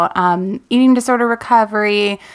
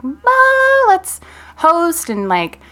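eager past self; 8 semitones higher than usual. Retold or imitated. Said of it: "I'm such a, like".

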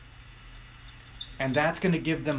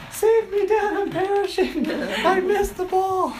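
high, loud mock wail through laughter; crying daughter laughing; 7 dB louder than usual. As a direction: play the sobbing kid while cracking up. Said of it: "She's crying".

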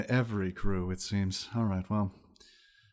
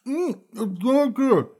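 high wizard lady voice; 13 semitones higher, 5 dB louder, slower than usual. Acted. GM voicing eating wizard.

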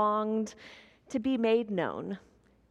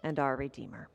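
lower, hushed prayerful voice; 5 semitones lower than usual. Acted. Pastor praying before the sermon, hushed and reverent.

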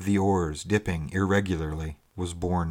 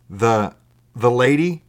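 halting, louder question rising at the end; abrupt; 4 semitones higher, 7 dB louder than usual. A narrator's reading. Will asking stranger about the woman, abruptly. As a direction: blurt it out after a stammer. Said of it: "said Will abruptly".

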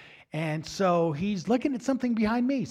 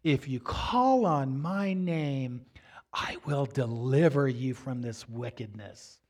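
slow, solemn voice speaking to the heart; Jesus; 4 semitones lower, slower than usual. Retold or imitated. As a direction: give a measured, assured promise, slowing right down. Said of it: "he speaks to me in my heart and says".

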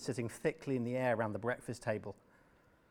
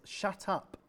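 curt shut up; 6 semitones higher than usual. Acted. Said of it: "Obed said".

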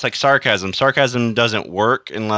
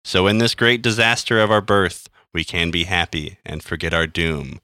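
poem reading; 2 semitones lower than usual. Acted. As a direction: read it like a somber poem. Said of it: "I'm going to read this poem now".

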